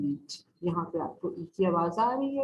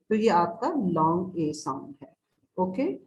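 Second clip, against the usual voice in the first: ordinary delivery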